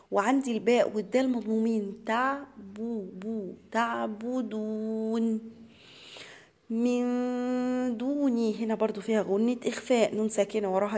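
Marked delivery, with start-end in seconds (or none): 2.1-8.5 drawn-out tajweed chant, each syllable held; slow Quran recitation. Shown as a demonstration. Teacher recites the verse to students as a slow chant. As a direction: recite it slowly for the students, holding the long vowels. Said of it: "أبينه كويس".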